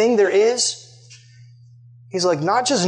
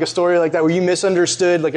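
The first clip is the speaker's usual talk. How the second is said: ordinary delivery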